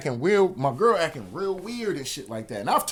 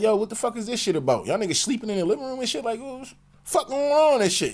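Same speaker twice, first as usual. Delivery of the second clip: angry confrontation; 8 semitones higher than usual. Retold or imitated. Confronting girlfriend at door.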